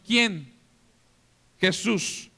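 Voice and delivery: normal talking voice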